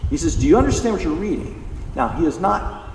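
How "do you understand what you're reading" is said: bold, raised-pitch quoted question. Retold or imitated. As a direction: put the question to him boldly. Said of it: "He does boldly".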